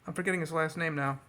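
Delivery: ordinary delivery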